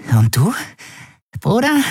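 very high, rising plea; hopeful wheedling. Acted. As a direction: fish for a yes. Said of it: "Mit hoffnungsvoller Grimasse". (hoffnungsvoll hoch fragend)

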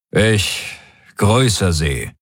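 slow, dry formal greeting; trocken. A narrator's reading. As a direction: greet them drily, drawing out the words. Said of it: "sagte Daraios trocken". (trocken und gedehnt)

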